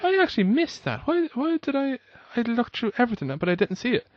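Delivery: high, puzzled replay of her own confusion; baffled self-quote. Retold or imitated. Retelling her Facebook feed mix-up, high and baffled. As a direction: relive the moment you realised you'd missed it. Reliving confusion over missed post.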